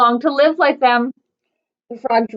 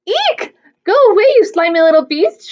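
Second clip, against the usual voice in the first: high, loud shriek at a frog; 7 semitones higher, 5 dB louder than usual. A narrator's reading. Princesses shrieking at the frog, loud and high. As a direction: squeal it out in disgust. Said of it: "shrieked Arabella and Lucinda".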